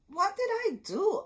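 put-on high, loud shocked question from a boy; a boy's alarmed outburst; upset cry. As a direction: cry it out, stunned and hurt. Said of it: "Oscar cried".

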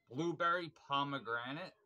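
normal talking voice

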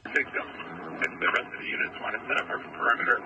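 crackly radio transmission; clipped call over a police radio; terse radio update. Real, not acted. Field unit over police radio.

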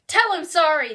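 loud, high yelled order. A narrator's reading. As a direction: cop yelling a command. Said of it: "the cop yelled".

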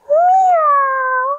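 a squeaky playful kitty meow; cat meow. Shown as a demonstration. Yoga teacher leading kids in cat pose, high playful meow.

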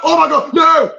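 sudden loud alarm, rising; panicked. Real, not acted. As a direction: blurt it out in panic. Panic at a near turnover.

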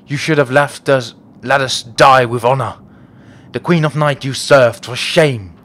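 declamatory game-character read; hammed-up formal pronouncement; fantasy warriors in dialogue. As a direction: proclaim it like a solemn warrior.

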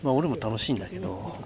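soft, half-whispered night talk; hushed voice. Real, not acted. 夜のキャンプ場、隣に客. (ひそひそ声)

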